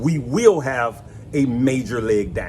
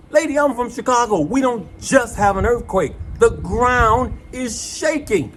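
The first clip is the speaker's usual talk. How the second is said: high agitated protest; 7 semitones higher than usual. Retold or imitated. Man lectures lady during quake.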